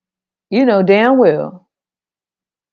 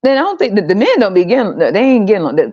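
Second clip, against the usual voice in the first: normal talking voice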